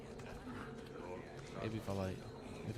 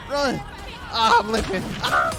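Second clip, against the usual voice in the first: being chased; 7 semitones higher, 11 dB louder than usual. Real, not acted. Fleeing while injured.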